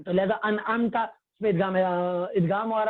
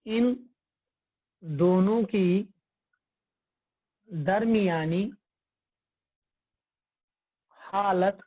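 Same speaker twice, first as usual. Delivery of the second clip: slow dictation; 2 semitones lower, much slower than usual. Shown as a demonstration. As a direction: dictate each word with long gaps. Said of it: "ابھی آپ لکھتے جائیں". (لکھوانے کے انداز میں بہت آہستہ)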